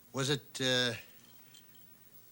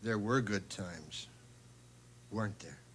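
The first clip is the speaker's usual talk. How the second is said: tender; 7 semitones lower, 6 dB quieter than usual. Acted. Tender reminiscing with ex-wife.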